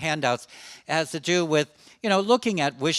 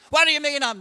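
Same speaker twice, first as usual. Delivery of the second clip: much higher and louder, flaring up; indignant outburst; 14 semitones higher, 6 dB louder, faster than usual. Retold or imitated. Older man going off again at the therapist, indignant. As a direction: burst out in offended anger. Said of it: "then they go off again".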